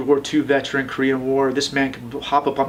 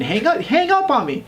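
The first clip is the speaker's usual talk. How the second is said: exasperated; 12 semitones higher than usual. Real, not acted. Exasperated about being hung up on.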